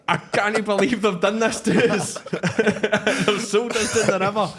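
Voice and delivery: high, laughing mock lament of a sheep farmer; mock India farmer. Podcast host voicing an Indian farmer over the trade deal, laughing mock lament. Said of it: "I thought you were gonna attempt an accent there".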